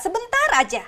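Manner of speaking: ordinary talk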